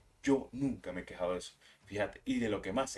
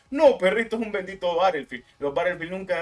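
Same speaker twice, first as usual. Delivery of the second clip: annoyed shout; 7 semitones higher, 9 dB louder than usual. Real, not acted. Shouted rebuttal to gamers.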